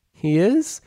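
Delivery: high, hopeful surprised rise; surprised question. Acted. Nervous bride hears groom is nervous too, high surprised. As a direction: perk up at the news.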